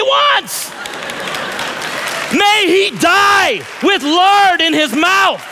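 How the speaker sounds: high, slow, booming commands to the family; pastoral authority shout. Pretend. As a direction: proclaim it like a pastor laying down the law. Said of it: "with pastoral authority".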